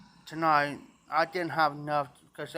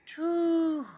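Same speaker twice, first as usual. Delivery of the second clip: high relieved phew. Real, not acted. Relief before kidney stone story.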